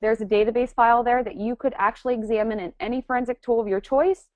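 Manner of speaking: normal talking voice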